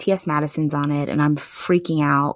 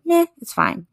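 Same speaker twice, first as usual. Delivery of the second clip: dismissive meh, pitched up; mock indifference; 12 semitones higher than usual. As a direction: shrug it off as a bored listener. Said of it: "we're like".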